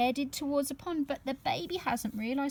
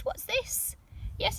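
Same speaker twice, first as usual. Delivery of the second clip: usual speaking voice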